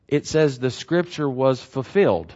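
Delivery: measured reading, giving each word room; slow, deliberate reading. Shown as a demonstration. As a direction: read the verse slowly and evenly. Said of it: "slower. Take a deep breath".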